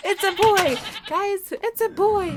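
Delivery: high, loud, slow joyful announcement; thrilled high reveal; excited. Real, not acted. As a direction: shout the news with delight.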